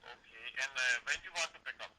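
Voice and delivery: ordinary talk, nothing marked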